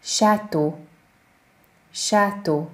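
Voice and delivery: Château as ʃato; French château. Shown as a demonstration. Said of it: "A, Ü".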